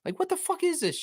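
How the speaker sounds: a disbelieving high-pitched what-the-fuck; outraged falsetto question; exasperated squeak. Real, not acted. Ranting at Raptors admin.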